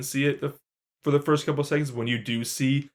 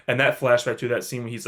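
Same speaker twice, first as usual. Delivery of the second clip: normal talking voice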